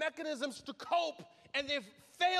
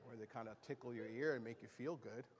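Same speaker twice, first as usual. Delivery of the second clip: low, soft grumbling visitor; mock first-time guest; 12 dB quieter, faster than usual. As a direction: complain like a bored newcomer. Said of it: "First time guests are like".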